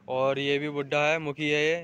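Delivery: ordinary talk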